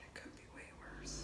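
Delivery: very soft, quick whisper; whispered self-reassurance. Real, not acted. Reminding herself it could be worse, whispering. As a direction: whisper it under your breath to yourself.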